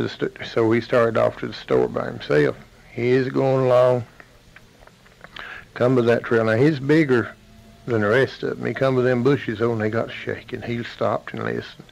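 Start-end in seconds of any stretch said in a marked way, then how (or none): none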